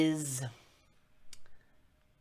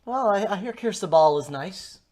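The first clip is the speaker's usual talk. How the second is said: higher, louder halting reply; stranger in character; 6 semitones higher, 7 dB louder than usual. Acted. Stranger answering where he's headed, higher in-character voice. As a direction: evasive traveler, pitched up and tentative.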